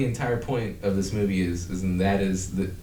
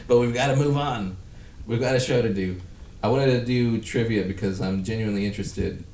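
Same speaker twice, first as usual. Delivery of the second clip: ordinary delivery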